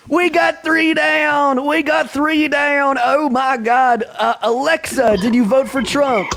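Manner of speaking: high, drawn-out hype calls; hype announcer. Acted. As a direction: crow the tally out to the crowd. Host hypes the tally of voters.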